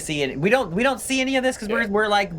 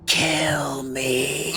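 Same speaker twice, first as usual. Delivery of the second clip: whispered; 5 semitones lower than usual. Pretend. Mock despair quote.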